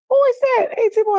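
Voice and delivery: high, loud mock gasp and question; gasping, hammed-up surprise; startled niece, imitated. Retelling niece's startled reaction on call.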